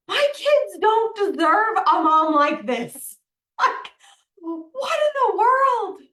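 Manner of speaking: high, loud, tearful cry of despair; reliving a sob of self-doubt, voice breaking; retold anguished crying. Mother recalling her closet breakdown, crying out loud and high.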